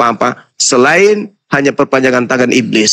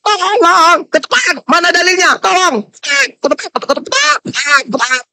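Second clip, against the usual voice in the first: high, shouted mock pleas for help; mock shouting for help; 13 semitones higher than usual. Preacher ridiculing opponents with high mock shouts for help. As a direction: wail like a cornered thief, hammed up. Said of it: "hanya bisa teriak".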